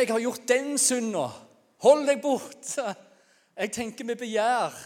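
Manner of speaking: loud, high, drawn-out cries; shouted confession. Acted. Sinner warning others to stay back, shouted confession. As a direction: shout your sins as a warning. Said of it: "Vi trenger ikke rope".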